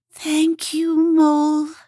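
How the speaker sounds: high small grateful voice; sighing Hedgehog. A narrator's reading. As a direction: thank her with relief. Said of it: "Hedgehog sighed".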